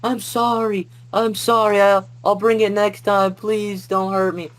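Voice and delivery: high, pleading mock wail, drawn out at the end; imitated bawling. Frightened student begs the yelling teacher, imitated bawling. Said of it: "bawling my eyes out and screaming".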